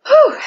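high blown-out whew; exhale whew. Real, not acted. Winded coach blows out a high whew during recovery. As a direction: puff it out, winded.

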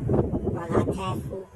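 ordinary delivery

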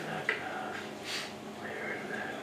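whispered under the breath; muttered curse. Retold or imitated. Cursing the shop clerk under the breath. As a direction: hiss it so nobody hears. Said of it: "And you're like".

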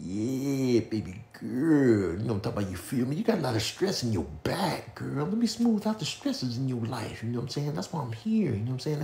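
a hushed smooth-talker voice; smooth seducer. Acted. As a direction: purr it like a slick masseur. Voicing a smooth massage guy.

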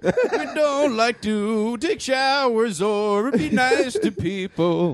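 put-on slow, high hammy French accent; bad French accent. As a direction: overdo a French accent, slowly. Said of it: "Nobody in this room can do a French accent".

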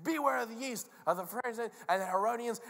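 urgent, proclaimed caution; serious warning. Acted. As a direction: call out the warning over everyone. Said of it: "has a real serious thing".

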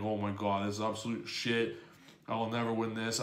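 retold low, quiet despair; dejected. Player recalling getting screwed in a match, dejected. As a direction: mutter it in mild defeat. Despairing over a lost game.

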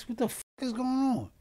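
high-pitched startled cry; bewildered alarm. Retold or imitated. Reliving waking after the crash.

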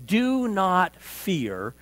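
very slow, weighty; solemn command. Acted. Preacher repeating God's words, very slow. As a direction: draw out each word gravely. Quote repeated after Hebrew word.

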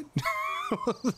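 high-pitched burst of laughter; real amused laugh. Real, not acted. Amused by a chat comment.

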